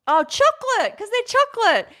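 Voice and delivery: high, loud "aha" as it comes back to her; remembering the missing ingredient; sudden realization. Real, not acted. Recalling her donut recipe, a sudden high loud "aha".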